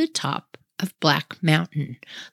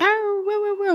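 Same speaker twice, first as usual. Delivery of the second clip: high wailing howl, much higher than her voice; imitated coyote howl; 13 semitones higher than usual. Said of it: "we hear, like".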